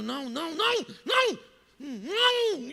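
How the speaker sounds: building into high, loud yells; shouting. Acted. Boy yelling at the TV to stop the trade, shouting. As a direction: start firm, then erupt into frantic shouts. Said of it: "E eu ficava gritando".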